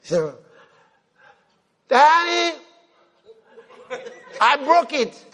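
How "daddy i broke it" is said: put-on higher, sheepish little-boy voice; be a child owning up to Daddy; childlike confession. Preacher voicing the rascal son to his father, sheepish child voice.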